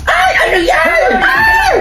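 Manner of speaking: very high, loud drawn-out cry; high-pitched, stretched-out startled yell; startled shriek. Acted. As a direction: shriek it out in alarm. Startled outburst in acted family quarrel.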